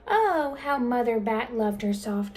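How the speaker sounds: ordinary delivery, nothing marked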